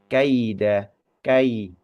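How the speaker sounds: kai with a and i both sounded; clear kai. Shown as a demonstration. Teacher showing kai in kaida. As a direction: say kai, not ke. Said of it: "Bacanya kai".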